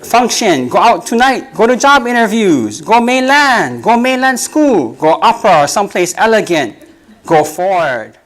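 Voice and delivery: rhythmic listing, slowed on key lines; poem recitation. Acted. Poet performs the pidgin poem for the audience, rhythmic reading. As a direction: recite it as a building litany.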